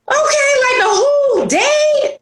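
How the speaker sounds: high, teary mock cry on "Okay"; half-joking, high wail of self-pity; mock whimper. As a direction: whimper it like a day just fell apart. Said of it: "And I'm like".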